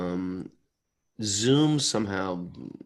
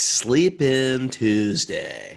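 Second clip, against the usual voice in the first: drawn-out, higher and louder hype; playful announcement; 4 semitones higher, 6 dB louder, much slower than usual. Pretend. Host announcing no five A M show, playful drawn-out hype. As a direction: stretch it out like a fun event name. Hyped-up name for late show.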